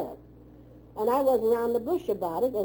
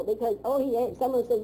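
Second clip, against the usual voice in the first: normal talking voice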